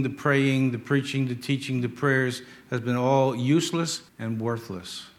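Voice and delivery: usual speaking voice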